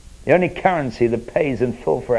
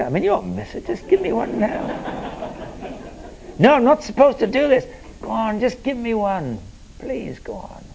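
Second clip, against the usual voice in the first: mock begging; 7 semitones higher, 5 dB quieter than usual. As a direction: coax him like a sheepish beggar. Begging a friend for coffee.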